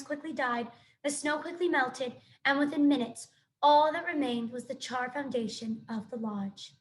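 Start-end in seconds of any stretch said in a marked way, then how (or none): none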